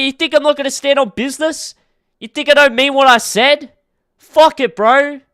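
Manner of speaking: high pitched shouting, hyped and defiant; defiant hype shout. Acted. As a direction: fire up the chat, all in. Hyped defiant rant to chat.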